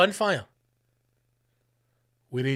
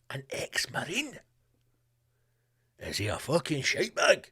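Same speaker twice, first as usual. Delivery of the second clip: scoffing disbelief; 5 dB quieter than usual. Real, not acted. Incredulous aside.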